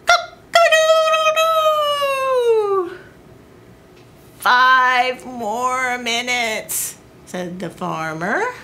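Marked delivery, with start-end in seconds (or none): 0.1-3.0 high, drawn-out cock-a-doodle-doo; a rooster waking the farm; rooster crow. Acted. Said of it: "the rooster crowed". 4.4-7.0 sleepy farmer. Acted. Sleepy farmer begs for time.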